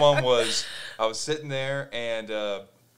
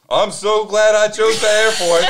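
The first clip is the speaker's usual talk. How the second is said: slow, loud, high mock relief; relieved recruit impression; 8 semitones higher, 11 dB louder, slower than usual. Imitated Air Force recruit after the threat, loudly relieved. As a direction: draw it out as a hugely relieved bystander.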